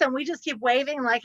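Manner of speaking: usual speaking voice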